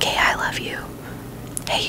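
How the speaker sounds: ordinary talk, nothing marked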